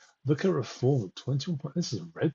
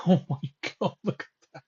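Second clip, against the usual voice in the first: laughing disbelief; 9 semitones higher, 3 dB louder than usual. Real, not acted. Laughs at election results.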